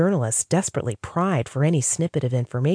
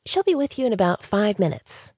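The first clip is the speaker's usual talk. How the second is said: higher, smiling receptionist voice; bubbly receptionist; 7 semitones higher than usual. Acted. Sandra reassuring Diana in the lobby, bright and smiling. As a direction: keep it sunny and helpful. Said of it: "the bright, bubbly smile once again".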